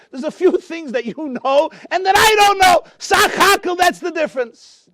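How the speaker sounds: the jester bursts out in tears at the rebbe; tearful shout. Retold or imitated. Jester's emotional punchline to rebbe.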